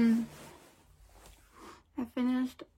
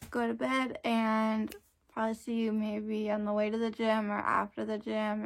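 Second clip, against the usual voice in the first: normal talking voice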